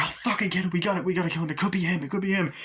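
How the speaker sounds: high, urgent mock alarm; retold panic. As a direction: act out a panicked crew. Acted panic of film characters.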